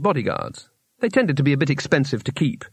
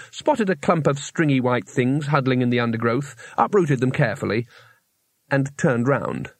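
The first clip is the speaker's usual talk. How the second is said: ordinary delivery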